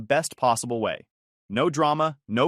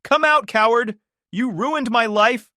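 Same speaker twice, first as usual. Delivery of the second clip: furious screaming, much higher and louder; yelling; 10 semitones higher, 7 dB louder, slower than usual. A narrator's reading. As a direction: scream it at the top of your lungs. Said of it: "yelling at the top of her lungs".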